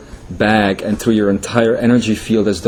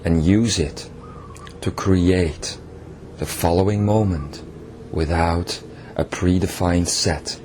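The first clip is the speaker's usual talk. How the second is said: guided meditation; 3 semitones lower, 5 dB quieter than usual. Acted. Channeled king leading listeners into meditation, soft guiding voice. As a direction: ease the listeners into letting go.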